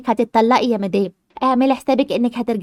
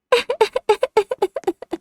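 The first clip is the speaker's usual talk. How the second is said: high, breathy mocking giggle; mocking laugh; 11 semitones higher than usual. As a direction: laugh at the foolish husband. Doctor laughs at husband.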